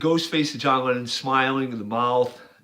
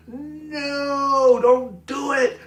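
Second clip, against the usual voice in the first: high, urgent warning to a son; pleading ghost voice; 13 semitones higher, 3 dB louder, much slower than usual. Retold or imitated. Ghost of John warns Sean not to fight, high and pleading. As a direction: plead way up high, begging him to stop. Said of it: "his ghost face with the eyes going".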